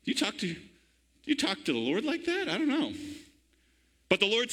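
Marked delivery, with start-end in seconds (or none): 0.0-2.9 mock disbelief. Preacher mock-scolds Jonah.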